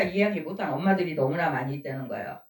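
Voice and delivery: ordinary delivery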